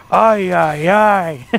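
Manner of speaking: lightly mimicked old man's exclamation; drawn-out "ay" in a hint of an old man; imitated old man. Caster imitating the old man at the train station, lightly mimicked. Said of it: "and walks off and goes".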